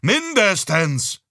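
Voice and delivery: drawn-out, loud, high word; loud insistence. Acted. Father stubbornly insists to his wife, loud and drawn-out. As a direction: stretch it out, insisting.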